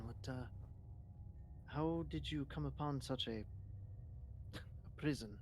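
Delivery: ordinary delivery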